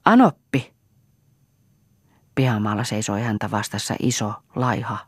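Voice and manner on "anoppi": a sharp, loud, high call of the name. A narrator's reading. Title called out as a name. (kovaa huudettu nimi)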